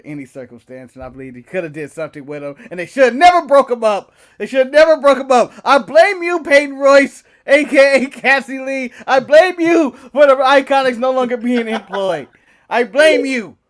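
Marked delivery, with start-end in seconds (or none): 2.7-5.5 louder, indignant insistence; emphatic rant. Real, not acted. Indignant rant about the Iconics. 5.6-13.6 louder, higher, playful blame; mock accusation. Mock accusation at [name].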